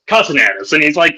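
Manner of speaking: normal talking voice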